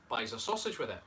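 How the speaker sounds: normal talking voice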